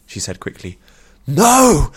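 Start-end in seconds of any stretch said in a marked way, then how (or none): 1.3-1.9 sudden high, loud cry of alarm; shrill yell. A narrator's reading. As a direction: scream it out, terrified. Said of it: "yelled Nuthia's mother out of nowhere, high and shrill".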